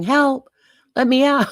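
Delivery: a high, loud retold cry for help; retold yell. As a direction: yell for help, half in fun. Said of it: "I was yelling".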